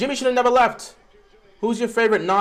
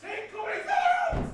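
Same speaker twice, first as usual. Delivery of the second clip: much higher, strained shout; desperate yell; 13 semitones higher, 8 dB quieter than usual. Real, not acted. Fan screaming for Zeller to be benched, desperate yell. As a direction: scream the demand at the screen.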